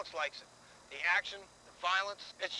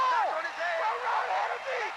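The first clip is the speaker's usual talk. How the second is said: cheering shout; 4 dB louder, much slower than usual. Real, not acted. Fan cheering team on.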